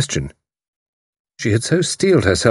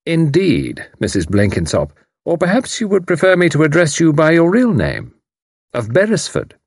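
lightly voiced, higher, mock-polite unmasking; mocking commander; 9 semitones higher than usual. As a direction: savour her real name. Haydock unmasks her.

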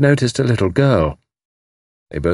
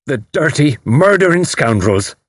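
outraged; 7 semitones higher, 4 dB louder than usual. A narrator's reading. Missus O'Rourke reacts to ransom note, outraged. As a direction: draw out the insult with relish and outrage.

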